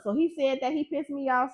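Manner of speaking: ordinary talk, nothing marked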